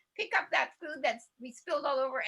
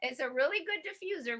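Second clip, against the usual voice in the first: ordinary delivery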